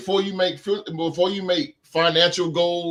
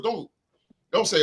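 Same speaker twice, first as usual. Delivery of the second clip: usual speaking voice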